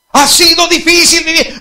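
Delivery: high, slow, furious shout; angry temperament. Acted. Son yelling at his father, high and slow. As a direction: spit it out bitterly, slowly. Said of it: "¿Y ve con qué temperamento le habla al hijo?".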